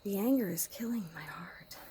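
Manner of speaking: hushed whisper. Real, not acted. Woman confiding about her angry partner in a whisper. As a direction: keep it to a secret whisper.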